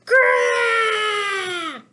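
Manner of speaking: a high, very loud tantrum yell; wordless tantrum scream; angry roar. Acted. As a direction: roar it out like a furious little bear. Bear's tantrum acted out.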